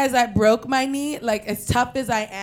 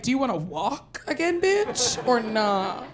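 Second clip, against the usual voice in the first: sassy retort; slower than usual. Retold or imitated. Doctor and dad mock her.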